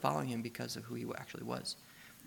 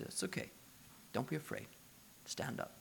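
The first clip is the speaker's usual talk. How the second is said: much softer, nearly whispered comfort; gentle reassurance; 13 dB quieter than usual. Acted. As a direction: hush him like a frightened friend. Jesus comforting terrified Peter.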